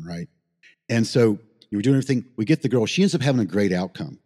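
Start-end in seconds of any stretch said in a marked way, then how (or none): none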